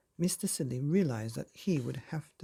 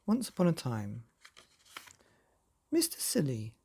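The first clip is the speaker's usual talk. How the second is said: plain reading voice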